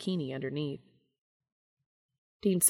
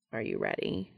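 deep serious voice; 5 dB quieter than usual. A narrator's reading. Cass, deep-voiced lover, serious.

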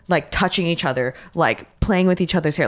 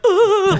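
high, loud tearful ugh; crying ugh; 5 dB louder than usual. Retold or imitated. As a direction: burst into a teary ugh. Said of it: "like, start crying".

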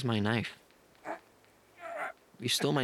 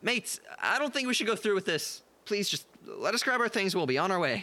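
higher, urgent plea; anxious pleading; 8 semitones higher than usual. Acted. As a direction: plead with mates to back off. Pleading to stop the fight.

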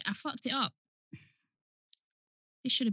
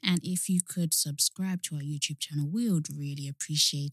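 low, soft outro request; softly spoken, monotone podcast plug; monotone sign-off; 9 semitones lower, 6 dB quieter than usual. Real, not acted. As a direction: ask for subscribers in a low monotone. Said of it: "We sound really monotone".

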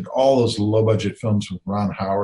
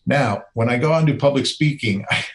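ordinary talk

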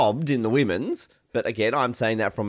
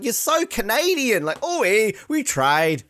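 mock Canadian; 9 semitones higher than usual.

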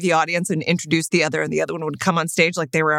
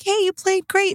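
bright comfort; 12 semitones higher than usual. Retold or imitated. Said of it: "I'm like".

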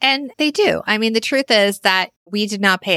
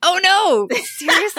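much higher, incredulous gasp of a reply; surprised; 10 semitones higher than usual. Real, not acted. Hearing the co-host paid cash for a car, surprised. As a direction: react in real disbelief.

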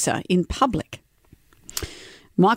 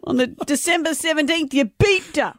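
retold angry accusation; 9 semitones higher than usual. Host reading the woman's side of the row, mock fury. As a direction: act out an outraged partner's accusation. Acted quarrel from a transcript.

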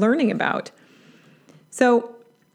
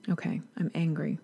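calm acknowledgement; 3 semitones lower, 9 dB quieter than usual. Acted. Acts calmly acknowledging anger after pausing.

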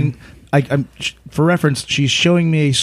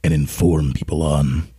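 low, measured radio-presenter read; 7 semitones lower than usual. Pretend. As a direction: drop low and read it like a broadcaster. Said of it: "I'll do it radio voice".

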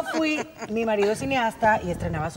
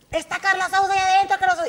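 excited fans; 8 semitones higher, faster than usual. Retold or imitated. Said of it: "todas las chavitas".

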